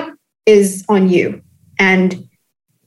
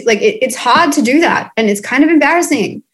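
ordinary delivery

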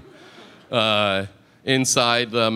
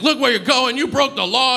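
high-pitched mock scream from an angry cyclist; retold angry yell; 11 semitones higher than usual. Cyclist hit by a cab door screams at the passenger, mock yell. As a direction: imitate him yelling, pitched right up but not full volume. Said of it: "he started screaming at me".

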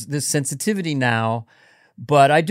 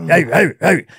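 caveman grunts; 2 semitones higher, 6 dB louder, faster than usual. Shown as a demonstration. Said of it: "pointing and grunting".

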